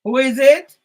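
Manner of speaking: loud call through a closed door. Real, not acted. Answering the knock at the door, calling out loud.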